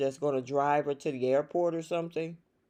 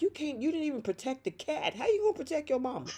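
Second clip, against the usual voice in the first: high, fast mock challenge; acted scoffing comeback; incredulous retort; 8 semitones higher, 6 dB quieter, much faster than usual. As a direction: fire it back high and quick, in disbelief.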